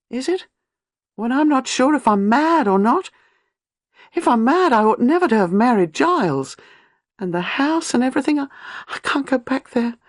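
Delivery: put-on high, tearful young wife on edge; anxious young wife pleading with an old lady; young woman in distress. A narrator's reading. Gwenda voiced, high and distressed.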